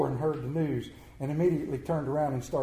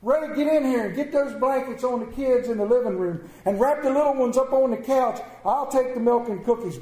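higher, louder rapid commands; urgent orders; 6 semitones higher, 4 dB louder than usual. Acted. Mother ordering Ray to wrap the kids, loud and urgent. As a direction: take charge in a hurry.

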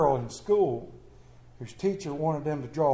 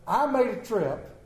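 ordinary talk, nothing marked

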